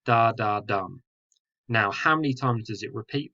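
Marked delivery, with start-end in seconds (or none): 0.0-1.0 quick da, da, then a landed dum; two light taps and a stressed dum; anapestic beat. Shown as a demonstration. Teacher showing the anapest, last beat stressed. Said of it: "So it goes".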